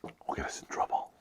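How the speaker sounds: hushed, barely-there whisper; secretive whisper. Pretend. Telling the child to tell nobody, secretive whisper.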